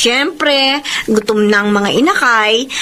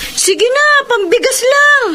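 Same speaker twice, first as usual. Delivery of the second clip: wheedling plea; 11 semitones higher, slower than usual. Acted. Wheedling neighbor begs money.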